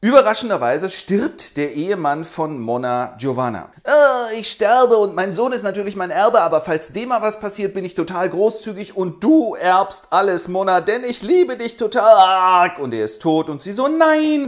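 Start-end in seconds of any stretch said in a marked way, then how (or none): 3.8-12.8 higher, louder mock dying husband. 13.9-14.5 high, loud mock lament; mock wail.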